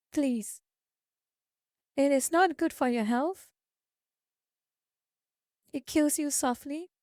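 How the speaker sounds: ordinary talk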